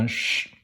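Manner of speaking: ch as a long hushing sh; hissed sh for the letters ch; mistaken sh sound. Shown as a demonstration. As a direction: draw out ch as "shhh". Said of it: "me lire le C H".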